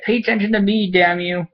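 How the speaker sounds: high, whiny put-on cat voice; voicing the cat. Vlogger voicing his cat demanding attention, high and whiny. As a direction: whine like a neglected pet. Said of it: "He's like".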